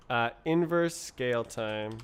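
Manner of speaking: normal talking voice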